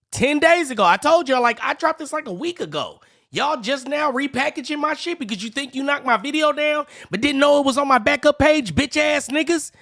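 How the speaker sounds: loud, heated rant, pitch up high; angry shouting. Real, not acted. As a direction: yell it out, fed up. Angry rant at rival channel.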